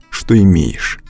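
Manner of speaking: normal talking voice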